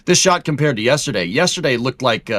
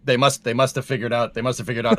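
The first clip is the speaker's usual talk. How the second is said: ordinary talk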